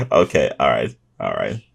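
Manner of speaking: ordinary talk, nothing marked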